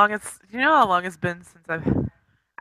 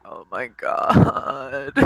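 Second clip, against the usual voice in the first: low, drawn-out anguished groan; anxious dread; 10 semitones lower, much slower than usual. Acted. Pacing and wringing her hands, anxious and anguished. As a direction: stretch it out in worried despair. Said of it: "she's pacing and wringing her hands".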